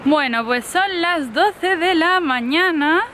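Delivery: high laughing through leftover tears, slow. Real, not acted. Mother recovering from happy tears, laughing.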